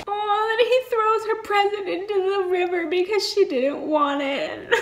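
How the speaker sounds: high, whiny near-crying; laughing mock wail. Real, not acted. Fan mourns the gift thrown in the river, mock-tearful wail. Overcome by sad scene, laughing.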